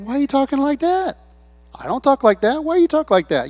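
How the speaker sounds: high-pitched, incredulous retold question; baffled challenge. Newcomer challenging churchgoers about how they talk, baffled.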